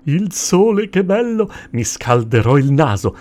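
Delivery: loud, high burst of delight; joyful cry. A narrator's reading. Crocodile thrilled to hear of sunny Brazil, joyful cry. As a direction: crow with joy, big and loud. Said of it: "gridò il coccodrillo raggiante di gioia".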